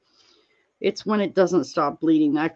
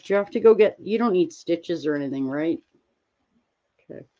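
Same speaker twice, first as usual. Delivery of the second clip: usual speaking voice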